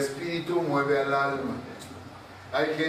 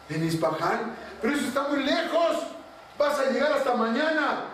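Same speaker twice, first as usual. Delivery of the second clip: much higher, quick incredulous king; astonished; 9 semitones higher than usual. Acted. King hearing the servant will flee far, astonished. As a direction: react in disbelief. King surprised at distant city.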